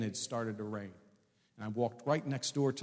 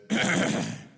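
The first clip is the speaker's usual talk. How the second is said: loud, showy throat clear; pompous throat-clearing; 7 semitones lower, 8 dB louder than usual. Acted. Seminary student about to lecture his wife, clearing his throat. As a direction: clear the throat self-importantly.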